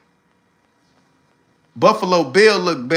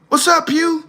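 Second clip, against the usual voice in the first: much higher, lightly voiced Lucifer; high mock Lucifer voice; 12 semitones higher than usual. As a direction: give Lucifer a high, sly answer. Lucifer answers the phone.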